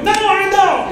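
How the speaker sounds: put-on high, loud bratty child voice; defiant child. Child defying parent about sister, loud high shout. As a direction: shout it back like a stubborn kid. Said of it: "sometimes they go".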